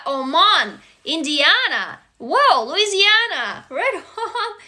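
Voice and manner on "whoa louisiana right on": high, drawn-out whoop breaking into laughter; delighted laughing. Real, not acted. Host greeting a Louisiana viewer, laughing with delight. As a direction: let a real laugh into the cheer.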